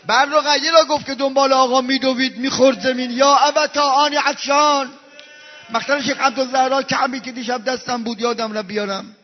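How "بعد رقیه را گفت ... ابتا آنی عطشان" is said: loud, fervent mourning sermon; preaching lament. Acted. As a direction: cry out the martyr's tale to the crowd. Preaching rawda at full force.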